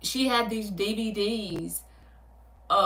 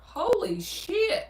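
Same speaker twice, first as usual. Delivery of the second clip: shocked; 11 semitones higher, much slower than usual. Real, not acted. Reacts to a viewer's comment.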